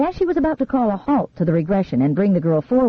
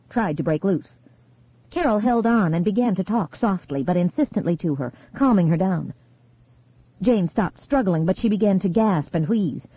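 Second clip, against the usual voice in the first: ordinary delivery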